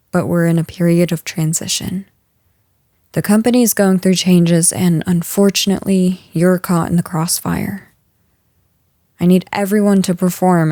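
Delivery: ordinary delivery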